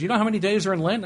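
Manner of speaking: high, fast quoted wife; quoting wife. Retold or imitated. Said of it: "she says".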